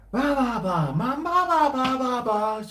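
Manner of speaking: high, far-off mock babble with a stuck mouth; mouth full of milk powder. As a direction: mimic gummed-up mumbling. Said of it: "You'd be like".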